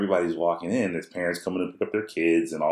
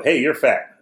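high, loud child imitation; blurting little kid; 7 semitones higher, 5 dB louder, slower than usual. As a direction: pipe up loudly as a small son. Repeats small son's blurted remark.

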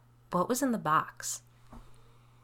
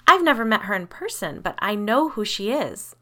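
higher, louder protest; insistent; 4 semitones higher, 8 dB louder than usual. A narrator's reading. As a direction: insist you are certain. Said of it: "I insisted".

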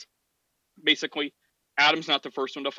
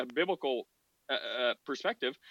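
ordinary delivery

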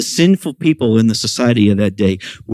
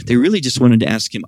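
ordinary talk, nothing marked

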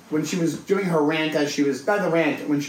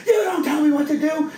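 high, raised shriek of fury; screaming woman; 11 semitones higher than usual. Retold or imitated. Crazy woman screaming at him against the subway door. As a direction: scream it right in his face. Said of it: "screaming in my face".

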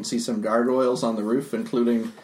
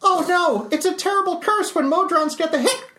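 high Calvin voice. Acted. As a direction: voice the little construct brightly.

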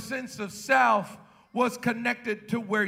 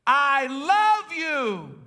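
very slow, drawn-out and tender; tender father; 3 dB louder, much slower than usual. Acted. Preacher voicing God the Father speaking love to his son, slow. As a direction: let the Father's love linger. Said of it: "Jesus hears the Father say".

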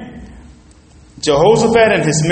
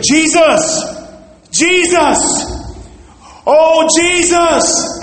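high, drawn-out cry to Jesus; acted desperate calling out, pitched way up; 11 semitones higher, much slower than usual. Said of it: "I just started calling on the name of the Lord".

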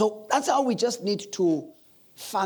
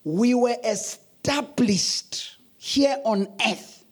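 preaching; 4 semitones higher, slower than usual. Acted. Preaching close.

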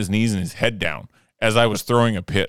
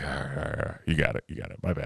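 softer, lower, meek backing down; suddenly tame, muttering agreement; sheepish; 4 semitones lower, 10 dB quieter, faster than usual. Retold or imitated. Hothead meekly backs down.

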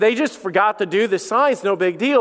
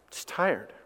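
weary and soft; 5 semitones lower, 11 dB quieter than usual. Real, not acted. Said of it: "one word that I normally say now".

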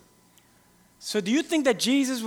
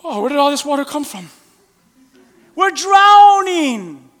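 high, loud drawn-out wail of drowning; frightened disciples crying out in the storm; mock panic; 4 semitones higher, 10 dB louder, slower than usual. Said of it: "he's like".

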